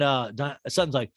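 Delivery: ordinary talk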